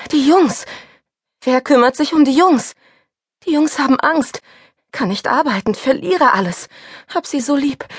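high, rushed frightened pleading; racing fearful thoughts, begging; mounting high panic ending in pleas. A narrator's reading. Panicked thoughts before crash.

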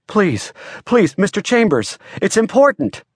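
urgent, high-pitched pleading. A narrator's reading. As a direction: plead with him not to hang up. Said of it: "the urgency in his voice".

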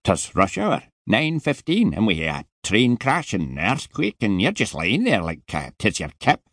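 put-on heavy Scottish accent, impatient and put out; a surly Scottish security man scolding; Scottish guard telling a boy to get up; grumpy Scottish guard. Scottish guard voiced in story.

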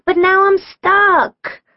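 high, drawn-out, slow wail. A narrator's reading. Kim tells sister she is stranded, wailing. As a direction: wail it in helpless distress. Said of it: "Kim wailed".

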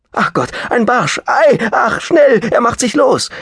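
very high, loud squeal; excited shriek. Acted. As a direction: squeal in a fluster over the fish. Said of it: "und schrie auf".

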